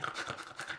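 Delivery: breathy hyena cackle; hyena giggle. Acted. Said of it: "he said".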